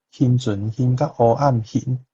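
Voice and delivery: ordinary talk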